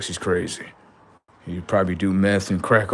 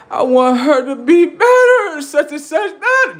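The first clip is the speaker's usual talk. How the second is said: whiny crying demand; 6 dB louder than usual. Retold or imitated. Blue-faced being begging to feel better, high whiny wail. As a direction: wail it like a sulking child. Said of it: "Blue face on".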